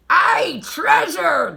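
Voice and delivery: high, loud crew cheer; excited pirate cheer; pirate crew shout. A narrator's reading. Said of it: "the others shouted".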